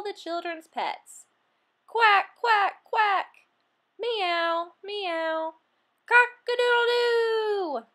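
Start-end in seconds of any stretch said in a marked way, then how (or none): 1.9-3.5 loud, high quacking like a duck. Acted. Classroom full of pet noises. 4.0-5.5 cat meowing. Acted. Classroom full of pet noises. 6.1-7.9 high, loud, slow rooster call; rooster crow. Acted. Classroom full of pet noises.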